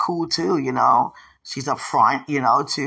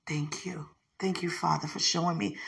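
soft, whispered thanks to God; quiet prayer; 9 dB quieter than usual. Real, not acted. A mother thanking God quietly, soft prayer. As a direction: pray it softly.

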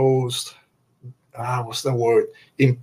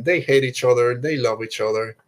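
ordinary delivery

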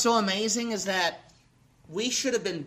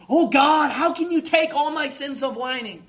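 higher, pleading preacher's prayer; impassioned repentant cry; 6 semitones higher, 4 dB louder than usual. Acted. Believer repenting to God over whining, impassioned cry. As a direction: cry out to God, raised and loud at first.

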